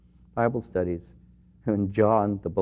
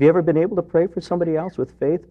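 higher, louder, fast; preaching at force; 8 semitones higher, 6 dB louder, faster than usual. Real, not acted. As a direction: drive the question home. Preacher rising to a challenge.

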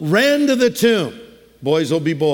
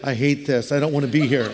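grumbling dread; 5 semitones lower, faster than usual. Retold or imitated. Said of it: "the last time I said".

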